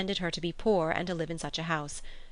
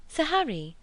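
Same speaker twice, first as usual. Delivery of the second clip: sudden high call of his name; exclaiming; 10 semitones higher than usual. A narrator's reading. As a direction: break in to stop him, voice up high. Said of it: "she exclaimed".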